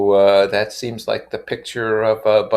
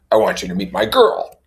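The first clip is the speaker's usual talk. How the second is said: pushy, higher and louder; a tipsy man insisting on it; insistent Tom; 12 semitones higher, 6 dB louder than usual. Acted. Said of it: "he insisted".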